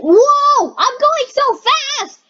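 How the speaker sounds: high, drawn-out shout of alarm; panicked cry. Pretend. Player speeding through the game, panicked cry. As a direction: cry out as if hurtling along.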